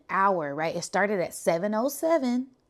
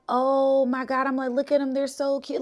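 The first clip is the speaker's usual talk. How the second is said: high, delighted squeal of awe; delighted mock gush at the cute fawns; gushing over cute fawns; 7 semitones higher, 4 dB louder than usual. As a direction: coo over the baby deer, pitched up. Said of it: "And I'm like".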